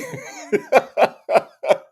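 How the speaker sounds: high cackling laugh; loud helpless giggling; real laughter. Real, not acted. Laughing at own line.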